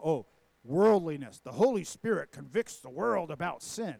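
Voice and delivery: loud, put-on disapproving church voice; hammed-up condemnation of worldliness; mock pious reading. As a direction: imitate a scandalized believer, leaning hard on the words. Mimics how "we" read the text.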